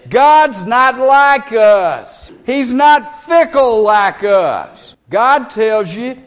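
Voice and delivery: slow, loud, drawn-out pulpit preaching; preacher slowing right down to insist God never changes; full-force preaching. Acted. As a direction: hammer each word home to the congregation. Preacher slows down and booms.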